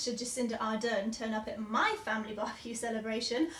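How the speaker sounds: ordinary talk, nothing marked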